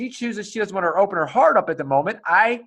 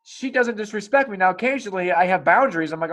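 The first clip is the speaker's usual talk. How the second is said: ordinary delivery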